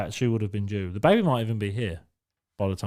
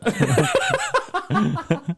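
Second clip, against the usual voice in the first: a burst of high, real laughter; squealing laugh. Real, not acted. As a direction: cackle at the joke. Laughs at own joke.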